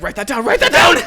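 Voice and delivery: mock-frantic, high and loud order; urgent exclamation. Imagining a director waking from a dream, frantic and loud. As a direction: play someone waking up bursting with an idea.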